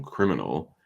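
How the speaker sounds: normal talking voice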